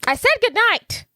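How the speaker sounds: high, loud I said good night; Fiona snapping. Retold or imitated. Fiona shutting Donkey out of her room, high and loud. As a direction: bark it up high to shut him out. Quoting Fiona's famous line.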